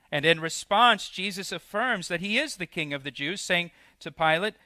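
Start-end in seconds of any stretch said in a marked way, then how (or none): none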